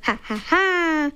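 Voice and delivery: dad laughs awkwardly to his boss, forced; fake forced laugh. A narrator's reading. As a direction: laugh to cover embarrassment. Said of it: "gar kein echtes Lachen".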